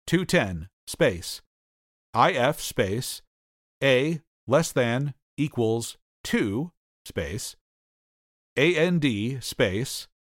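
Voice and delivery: slow, spelled-out dictation; dictating. Shown as a demonstration. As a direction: read code out character by character. Reading a code line aloud.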